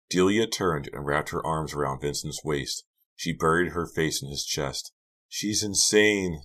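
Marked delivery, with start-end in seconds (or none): 5.3-6.4 slow, higher distressed moan. A narrator's reading. Delia buries her face in Vincent's chest, moaning. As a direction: moan it out slowly. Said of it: "Delia moaned".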